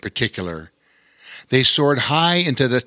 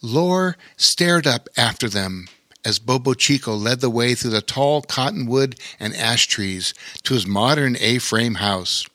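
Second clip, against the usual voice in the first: ordinary delivery, nothing marked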